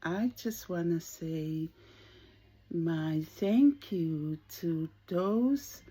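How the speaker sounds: ordinary talk, nothing marked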